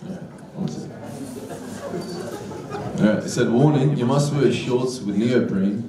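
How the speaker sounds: ordinary talk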